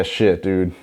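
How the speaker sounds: usual speaking voice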